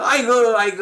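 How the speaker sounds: loud, high yelp for mother; mock cry of pain. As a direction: imitate a child who has fallen. Acts child crying out on falling.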